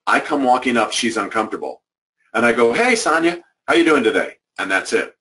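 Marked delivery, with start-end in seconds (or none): 2.7-4.4 bright, high "hey" and a friendly check-in; mock-innocent hello to a colleague who feels guilty; cheery greeting. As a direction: greet her as if nothing happened.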